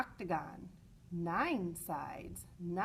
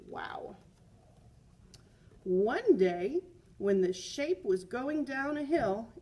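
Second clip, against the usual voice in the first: put-on lively read-aloud for children; story reading; 4 semitones higher, 6 dB louder than usual. A narrator's reading. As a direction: read the picture book to the class with energy. Reads picture book aloud.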